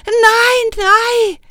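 high, loud desperate no; scream. Acted. Miranda screaming while the witch holds her, high and loud. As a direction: shriek it, struggling to get free. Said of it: "skreg Miranda".

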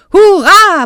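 roaring cheer. A narrator's reading. Dragon Oscar cheers at being a dragon again, roaring. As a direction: roar it out with joy. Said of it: "brølede Oscar".

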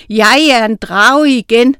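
joyful dragon. A narrator's reading. Dragon Oscar rejoices at his change, loud and happy. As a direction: boom it out happily.

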